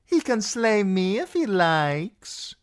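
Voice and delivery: high, slow, flirtatious woman's voice; drawn-out high coquettish call; dancer calling out. Acted. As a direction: call out a teasing come-on. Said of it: "One of the dancers called out".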